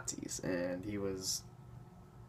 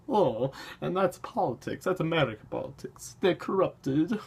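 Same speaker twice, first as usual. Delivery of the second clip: high-pitched British parody, chortling; hammed-up British accent, halting, with laughs; mock British accent; 6 semitones higher than usual. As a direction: play a spokesperson in a put-on British voice. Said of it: "I'm giving them a British accent".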